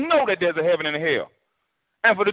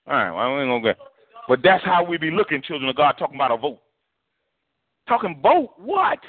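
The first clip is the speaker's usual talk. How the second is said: ordinary delivery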